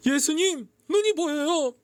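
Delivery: high, excited calling out; overjoyed exclamation. A narrator's reading. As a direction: announce a miracle to everyone. Healed blind man tells the crowd. (기쁨에 벅찬 외침)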